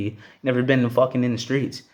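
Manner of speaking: usual speaking voice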